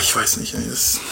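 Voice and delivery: voiceless whisper trailing off; whispered doubt. Retold or imitated. Paul torn between staying and going, whispered. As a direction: breathe it out, lost for words.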